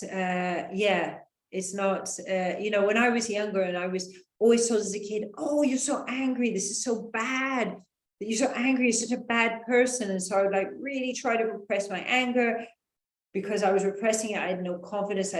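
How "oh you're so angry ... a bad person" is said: imitating a scolding adult, higher and raised; mock telling-off of an angry kid; hammed-up disapproving grown-up.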